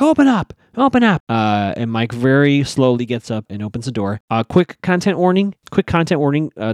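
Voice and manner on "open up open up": high, loud mock yell; pissed. Recapper voicing a dad storming up to his son's room, pissed. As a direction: imitate an angry dad demanding entry. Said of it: "Byron gets really pissed".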